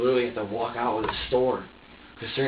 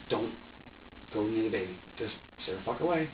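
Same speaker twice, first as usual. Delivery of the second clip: low-key, softer warning to oneself; muted self-warning; 4 semitones lower, 7 dB quieter than usual. Retold or imitated. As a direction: tell yourself quietly to back off. Retells warning himself off baby.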